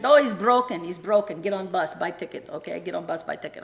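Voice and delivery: lightly voiced foreigner in broken English, loud start settling down; helpful local man. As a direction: imitate a local giving simple instructions. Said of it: "some very nice guy who said".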